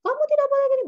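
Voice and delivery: very high, loud mock scolding; marah-marah. Shown as a demonstration. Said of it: "Intonasi yang tinggi ini bukan marah-marah". (pura-pura memarahi anak dengan nada tinggi)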